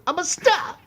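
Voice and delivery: very high, put-on voice; quoting Dog Day Afternoon in falsetto; movie quote. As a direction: shriek it like a camp star.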